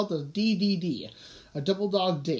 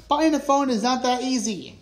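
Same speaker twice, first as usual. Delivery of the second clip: higher, louder objection; exasperated, pitched-up protest; 5 semitones higher, 5 dB louder than usual. A narrator's reading. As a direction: complain with a raised voice. Everest protests the phone rule.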